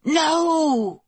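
high, drawn-out cry of horror; shriek. A narrator's reading. Queen seeing her old servant in danger, shrieked. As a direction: cry out high, without much added volume. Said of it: "the Queen shrieked".

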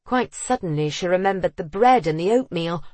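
plain reading voice

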